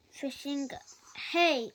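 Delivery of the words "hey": loud, higher call across the pond; bossy shouted hey. A narrator's reading. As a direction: shout to stop him. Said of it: "he shouted".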